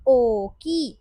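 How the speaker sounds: ओ की with a loud, long ओ; long vowel. Shown as a demonstration. Teacher giving an example of double ओ, first vowel held long. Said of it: "ओ का long sound ओ होता है".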